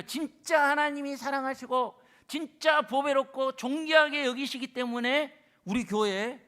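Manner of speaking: loud, high, slow emphatic preaching; preaching at full force. Real, not acted. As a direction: drive the point home. Preaching climax. (열정적으로 설교하듯)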